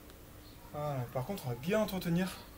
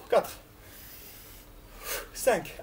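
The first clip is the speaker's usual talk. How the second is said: ordinary delivery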